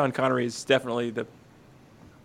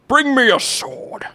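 commanding king; 9 semitones higher, 3 dB louder, slower than usual. Acted. King's command acted out.

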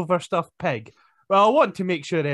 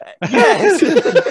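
laughing; 9 dB louder than usual. Real, not acted. Laughing through a reply.

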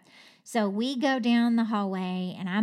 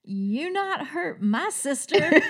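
put-on slow, high, louder child's threat; fierce little girl; 6 semitones higher, 5 dB louder, slower than usual. Little sister confronts the nurse, mock-fierce child voice. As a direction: play a small kid defending her sister. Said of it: "And she says".

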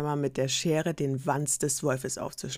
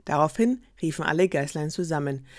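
usual speaking voice

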